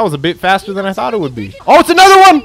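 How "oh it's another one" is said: high, loud startled call; alarmed surprise. Real, not acted. Player spotting another Titan, alarmed shout. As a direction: cry out at a sudden sighting.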